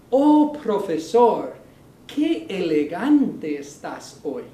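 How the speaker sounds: slow, mock-admiring tease; teasing compliment. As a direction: rib the teacher about his suit. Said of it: "Now you're making fun of me".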